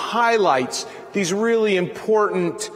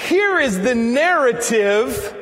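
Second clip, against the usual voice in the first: ordinary talk, nothing marked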